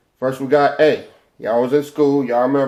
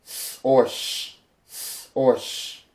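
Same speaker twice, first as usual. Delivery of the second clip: whispered sound demo; 6 dB quieter, slower than usual. Shown as a demonstration. Teacher whispers the two sounds of Shen slowly. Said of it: "Then we got Shen".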